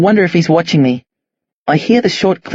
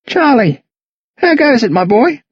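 put-on higher, bright old neighbour greeting; cheery old man; 7 semitones higher than usual. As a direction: call out warmly, a genial old bloke. Old neighbour Jack greets boy.

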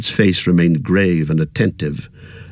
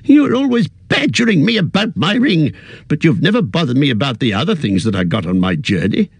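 higher, sharp and suspicious; old hobbit turning prickly; suspicious and annoyed; 7 semitones higher than usual. Acted. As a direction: bristle at the question. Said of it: "sharp with suspicion and annoyance".

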